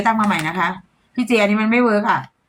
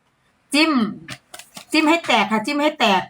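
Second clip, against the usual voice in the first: demo phrase; 5 semitones higher, slower than usual. Shown as a demonstration. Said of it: "เช่น".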